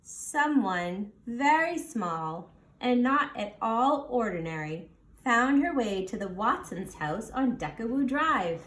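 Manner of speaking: ordinary delivery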